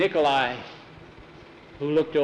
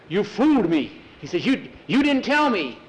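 high, indignant surprise; surprised outburst; 6 semitones higher, 3 dB louder than usual. A narrator's reading. Agar finds he was fooled, surprised outburst. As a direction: burst out high, as if just tricked. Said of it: "Agar looked surprised".

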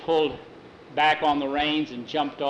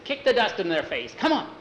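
rising call to the horse, last words high; urging the horse; 6 semitones higher than usual. Acted. Jackie spurring Rainbow on in the race, climbing urgent call. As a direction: egg the horse on mid-race.

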